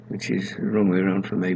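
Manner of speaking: ordinary delivery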